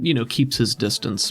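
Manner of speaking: normal talking voice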